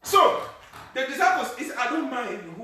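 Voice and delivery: sudden high, loud cry then settling; burst of preaching. Acted. Pastor resuming the story with a loud high cry. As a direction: shout it and come back down.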